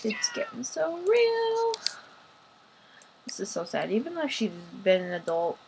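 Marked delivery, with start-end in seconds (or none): 0.0-1.7 mock dramatic. Dramatic repeat, very high.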